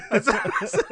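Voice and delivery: usual speaking voice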